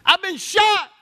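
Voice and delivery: high, loud cry of a shot soldier; wounded soldier. Retold or imitated. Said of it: "And he goes".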